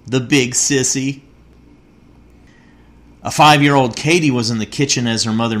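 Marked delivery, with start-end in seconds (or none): 0.1-1.2 a hint of a pouting little boy; shaky boy's voice. A narrator's reading. Boy grumbling about his father in the dark, lightly voiced. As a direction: mutter the punchline like a sulky child. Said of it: "the boy's shaky voice saying".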